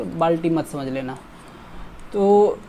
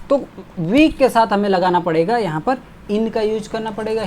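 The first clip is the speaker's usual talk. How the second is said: ordinary talk, nothing marked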